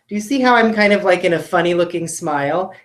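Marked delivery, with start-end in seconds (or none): none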